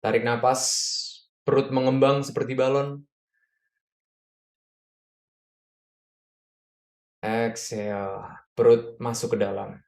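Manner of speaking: calm breathing coach cues; guided breathing. Acted. Leads a breathing exercise.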